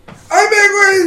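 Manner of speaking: high insisting cry; hammed-up protest; mock indignant yell. Retelling Jill arguing with the pony guy, high mock yell.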